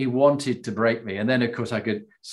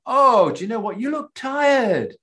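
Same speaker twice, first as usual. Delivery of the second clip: mock polite enemy; 11 semitones higher than usual. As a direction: ham up a politely considerate enemy offering tea. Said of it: "the enemy don't go".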